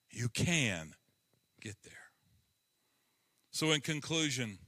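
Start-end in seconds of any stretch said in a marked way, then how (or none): 0.1-1.9 hushed. Acted. Drops to a whisper.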